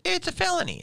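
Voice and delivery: very high falsetto verdict; mock outcry. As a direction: echo the rallying cry way up in pitch.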